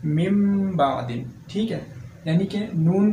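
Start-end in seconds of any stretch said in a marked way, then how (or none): 0.1-1.3 मिम बादिन very slow, m before the b; careful, drawn-out recitation; iqlab demo. Shown as a demonstration. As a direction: slowly turn the n into m in मिम बादिन. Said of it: "ये लफ्ज़ कैसे पढ़ेंगे".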